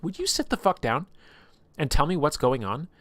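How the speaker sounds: high, insistent retold scolding; acted exasperation, pitched well up; exasperated demand. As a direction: order him to stop fleeing.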